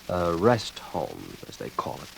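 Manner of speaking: slow, dropping to a near-whisper; bitter understatement. Acted. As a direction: let the euphemism sink away, slow and low. Bitter remark about asylum.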